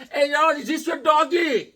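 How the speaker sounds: high-pitched, shouted foreigner impression; foreign guy. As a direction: call out in a put-on, high foreign voice. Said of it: "he was a foreign guy".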